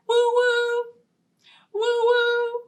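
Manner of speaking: high hooting train whistle woo-woo; playful whistle call. Pretend. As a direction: hoot like a toy train.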